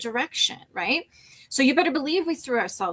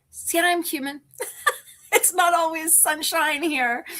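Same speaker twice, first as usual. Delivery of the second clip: higher voice, laughing through real tears; tearful; 5 semitones higher than usual. Real, not acted. Vlogger admitting to viewers she's human, tearful. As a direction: crack into a small laugh while crying. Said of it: "till you've cried on your channel".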